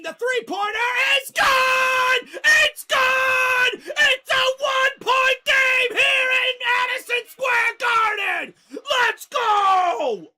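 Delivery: loud, high, thrilled roar; ecstatic shout. Real, not acted. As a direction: scream it for the home crowd. Knicks cut lead to one.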